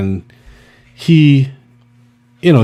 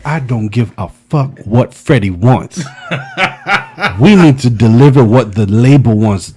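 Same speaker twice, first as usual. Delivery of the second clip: louder, laughing mock tirade; bossy manager rant; 2 semitones higher, 5 dB louder than usual. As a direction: lay down the law, half laughing. Pit bull manager scolding him.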